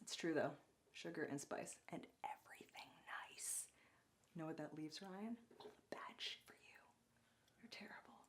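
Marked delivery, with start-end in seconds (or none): 0.9-3.7 sinister whispered rhyme. Pretend. Host whispering the rhyme to co-host Ryan, whispered. 4.3-8.2 conspiratorial teasing whisper. Pretend. Teasing co-host Ryan in a whisper, whispered.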